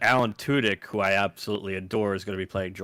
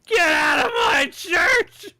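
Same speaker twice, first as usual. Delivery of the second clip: full-throated scream, very high and drawn out; loud, stretched-out movie-quote scream; Friar Tuck; 6 dB louder, slower than usual. Acted. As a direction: bellow it slowly in outrage.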